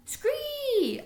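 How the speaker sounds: very high screeching scree; piercing high frog cry; frog scream. A narrator's reading. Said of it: "Og repeated".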